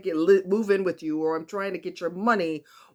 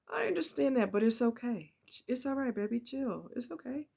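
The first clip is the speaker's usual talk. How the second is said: higher, softer reassurance fading away; soothing partner; 5 semitones higher, 5 dB quieter than usual. Retold or imitated. Partner gently calming the driver, higher and softer. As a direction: settle them down softly, baby. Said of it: "they're gonna be like".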